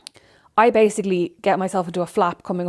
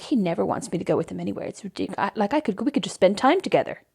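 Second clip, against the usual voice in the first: sulky mutter; 5 dB quieter than usual. Retold or imitated. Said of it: "at home sulking".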